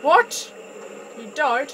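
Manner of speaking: high, loud startled question; sharp rising cry of shock; shocked disbelief. Real, not acted. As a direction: blurt it out in real alarm. Learns teammate died in game.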